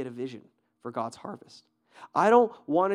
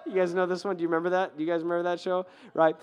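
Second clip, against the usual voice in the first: ordinary talk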